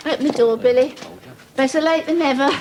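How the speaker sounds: ordinary talk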